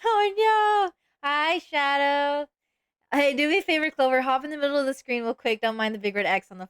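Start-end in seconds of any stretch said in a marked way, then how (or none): 0.0-0.9 sudden high yell of alarm; panicked shout. Real, not acted. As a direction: cry out in dismay as it happens. Panicked reaction to Shadow's attack.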